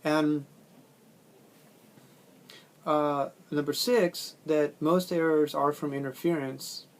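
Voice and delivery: normal talking voice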